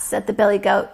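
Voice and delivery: usual speaking voice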